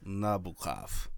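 put-on low, slow Russian accent on "Nabokov", NAH-bo-kov; mock Russian accent. Co-host shows off how a Russian says the name, mock Russian accent. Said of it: "That was my Russian accent".

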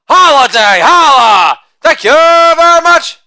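loud sing-song holiday chant; shouted catchphrase. Acted. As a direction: belt the tag line like a party cheer. Catchphrase chant after each joke.

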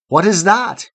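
put-on high startled cry of a scared child; child crying out at owls in the dark; frightened cry. A narrator's reading. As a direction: yelp it out at the hooting owls. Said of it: "he cried in terror".

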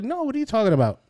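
high mock-innocent denial; feigned innocence. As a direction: play dumb, brightly. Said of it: "I was like".

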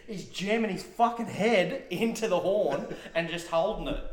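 ordinary talk

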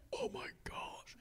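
breathless whispered awe, mocking the listener; awed whisper. Preacher mocking listeners awed by an accent, whispered. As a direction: whisper it as if struck dumb. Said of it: "and you're just like".

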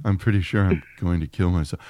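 low, soft, deadpan voice; mock-bleak line in a deep monotone; Neil Breen. Showing off the praised impression, low and toneless. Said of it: "that's a pretty good impersonation of Breen".

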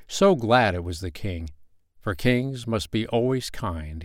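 ordinary delivery

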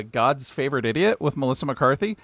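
ordinary delivery

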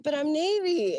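high whine; whiny plea. Retold or imitated. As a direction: protest it like a kid. Pleading to petty officer.

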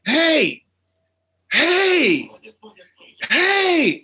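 drawn-out, high-pitched calls of alarm; mock yell, pitched well up; retold yelling. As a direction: imitate yelling to get someone's attention, rising with each call. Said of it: "my friend is yelling at him".

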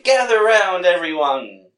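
loud, high carnival barker call; loud street performer hustle. Retold or imitated. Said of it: "I just can't picture Albert saying".

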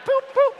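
boop boop, squeaky high; wiper noise imitation. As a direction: mimic wipers swishing on.

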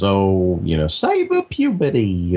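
usual speaking voice

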